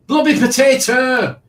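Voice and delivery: high, loud hype shout; announcing shout. Real, not acted. Quiz host calling out a player climbing the charts, high shout. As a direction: belt the name out like a cheer.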